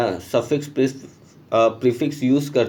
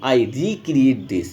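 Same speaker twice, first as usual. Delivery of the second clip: slow "recreate" with weight on re; stress on re; much slower than usual. Shown as a demonstration. Teacher showing the class where the stress falls, stress on re. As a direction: lean on the re of "recreate" and say it slowly. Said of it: "stress दे रहे हैं री पे".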